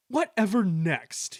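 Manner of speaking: high, loud, drawn-out indignation; haughty outraged snort. A narrator's reading. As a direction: snort it in disbelief. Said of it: "he snorted".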